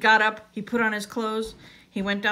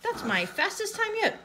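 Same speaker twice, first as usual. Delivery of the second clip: high, happy boast; SpongeBob; 13 semitones higher than usual. A narrator's reading. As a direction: proudly crow in a high, gleeful voice. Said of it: "yelled SpongeBob happily".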